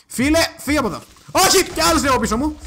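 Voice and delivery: high, loud cries of alarm; panicked shouting. Real, not acted. Player finds another mob behind, panicked shouting. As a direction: shout them away in a panic.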